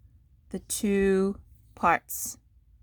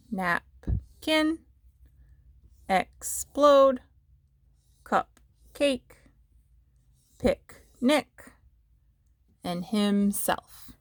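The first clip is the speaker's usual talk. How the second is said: NAP-KIN, EX-PLODE, CUP-CAKE, PIC-NIC, HIM-SELF, a gap between the two parts; split into syllables; 2 semitones higher, much slower than usual. Shown as a demonstration. Teacher scooping the two parts of each word, syllable by syllable. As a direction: break Napkin, explode, cupcake, picnic, himself into two separate beats each. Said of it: "if we were gonna scoop them".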